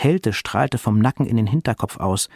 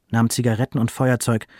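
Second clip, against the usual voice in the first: usual speaking voice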